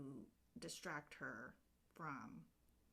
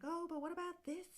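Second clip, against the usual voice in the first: fairy voice; 12 semitones higher, 3 dB louder, faster than usual. Retold or imitated. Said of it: "another fairy's like".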